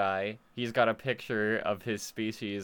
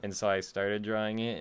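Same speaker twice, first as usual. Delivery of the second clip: ordinary talk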